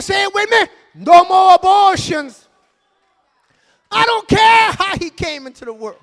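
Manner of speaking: shouted sermon over music; full-force preaching. Acted. Pastor preaches to the church, shouting over the music. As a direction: call it down in Jesus' name.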